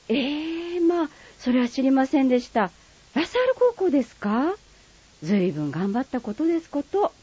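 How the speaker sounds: lightly voiced refined lady, polite standard speech; polite standard Japanese. Friend replying politely in light standard Japanese. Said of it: "標準語と鹿児島弁".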